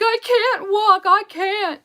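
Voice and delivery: loud, high, stretched-out protest; mock wailing. Stepmom mimics boy refusing to get up, wailing. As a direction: imitate a child crying out dramatically.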